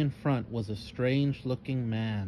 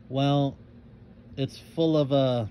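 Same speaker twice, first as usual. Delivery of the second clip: stuttering; 3 semitones higher, slower than usual. A narrator's reading. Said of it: "Cassie stuttered".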